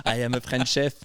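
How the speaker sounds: hammed-up French accent, laughing through it; beginner's French-accented English. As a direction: play a newcomer's thick French accent, amused. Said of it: "At the beginning, it's really the".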